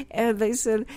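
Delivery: ordinary talk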